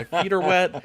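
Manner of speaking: whine-rant it in mock fury; angry complaint. Guy retelling his breakdown in the wet backyard, angry. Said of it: "I was so angry".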